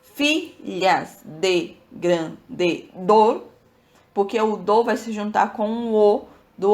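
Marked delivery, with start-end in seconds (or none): 0.0-3.4 stretched very slowly, word by word; teacher dragging out the verse to count it; very slow scansion. Shown as a demonstration. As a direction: pull each syllable apart slowly. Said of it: "a gente vai fazer assim".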